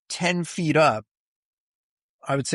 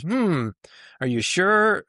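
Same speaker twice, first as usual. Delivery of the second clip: higher, doubtful "hmm, are you sure?" voiced lightly; mock skeptical; 7 semitones higher, slower than usual. Human ego doubting the afterlife, lightly mock skeptical. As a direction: play the doubting ego, questioning it.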